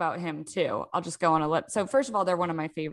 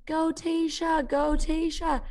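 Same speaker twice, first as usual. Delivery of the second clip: high, slow cheering from the crowd; 9 semitones higher, slower than usual. Retold or imitated. Runner imitating the crowd yelling her friend's name. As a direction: call it out like a spectator on the course. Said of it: "I heard people around me going".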